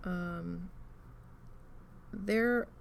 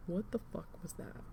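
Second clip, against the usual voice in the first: startled mutter; 9 dB quieter than usual. Retold or imitated. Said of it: "and I'm like".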